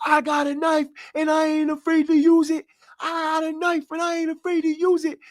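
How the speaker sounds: very high falsetto mock scream; hammed-up crazed squeal; imitated screaming threat. Imitating inmate threatening cops with knife, mock screaming. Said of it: "and scream, tell the cops".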